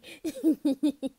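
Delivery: high, loud giggles; uncontained high laughter; giggling fit. Acted. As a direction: giggle helplessly after apologising. Laughs again after apologising.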